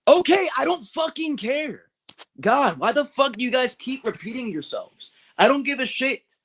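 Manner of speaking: high, exasperated outburst; fed up and snapping at chat. Real, not acted.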